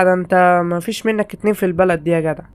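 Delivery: normal talking voice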